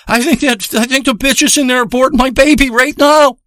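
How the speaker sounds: high, urgent voice of a frantic friend; panicked. Retold or imitated. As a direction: blurt out the alarm, pitched well up. Panicked friend quoted on the phone.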